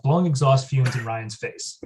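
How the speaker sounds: ordinary talk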